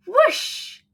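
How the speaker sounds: high swooping whoosh. A narrator's reading. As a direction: sweep the word up and away. Broom takes off.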